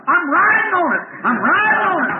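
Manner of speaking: high, full-voiced exultant holler. Preacher acting a man jumping up mid-floor, hollering. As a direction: holler it to the whole room. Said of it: "He jumped up in the middle of the floor hollering".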